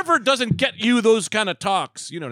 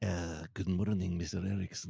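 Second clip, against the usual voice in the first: put-on maybe-Mexican accent; 12 semitones lower, 14 dB quieter than usual. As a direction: ease into a hazy, hard-to-place Mexican accent. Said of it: "Is that a Mexican accent?".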